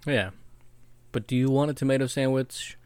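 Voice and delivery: usual speaking voice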